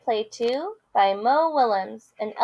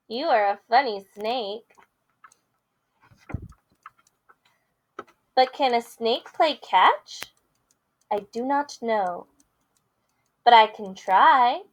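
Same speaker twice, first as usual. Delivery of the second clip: lively picture-book read-aloud with character voices; reading to children; 5 semitones higher, 5 dB louder, slower than usual. Acted. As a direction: read it big for the kids.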